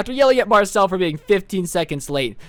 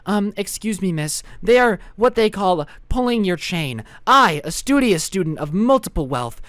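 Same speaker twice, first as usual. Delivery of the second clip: prim, over-formal student voice; hammed-up teacher's pet; straight-A know-it-all. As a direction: imitate a studious snitch lecturing the teacher. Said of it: "literally a straight A student".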